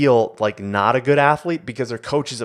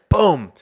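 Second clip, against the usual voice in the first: a sudden, loud and high boom for the landing; punchy mock impact boom; 8 semitones higher, 8 dB louder than usual. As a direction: slam the landing sound out. Landing sound in retold vault.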